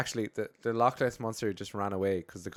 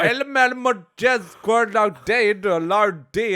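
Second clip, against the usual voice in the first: high, loud, slow Scottish gibberish; hammed-up wailing ancient Scottish villager; garbled old Scottish; 11 semitones higher, 12 dB louder, slower than usual. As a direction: holler unintelligible old Scots, drawn out. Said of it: "minus a few hundred years and education".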